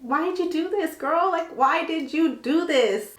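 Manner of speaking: high-pitched, incredulous whine; slow down into an exasperated, high-voiced why; acted-out dismay at a friend; dismayed reproach. Retold reproach to sobbing friend.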